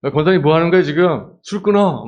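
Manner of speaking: high, loud shout at her; yelling pastor. Pretend. Pastor yells at praying deaconess, loud and high. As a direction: holler at the deaconess to quit drinking.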